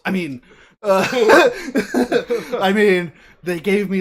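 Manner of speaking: loud, high laughing through words. Real, not acted. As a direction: laugh while talking.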